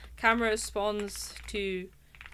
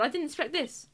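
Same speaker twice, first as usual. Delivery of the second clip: ordinary talk, nothing marked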